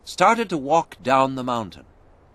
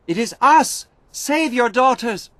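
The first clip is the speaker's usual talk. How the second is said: much higher, loud plea; pleading shout; 13 semitones higher, 5 dB louder than usual. A narrator's reading. Alice begging the fort to save them, shouting. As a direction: call up to the wall urgently.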